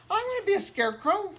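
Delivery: put-on high, quick little child voice; little boy's voice. Scarecrow boy telling his dad what he wants, high and eager. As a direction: pipe it up like an eager small boy.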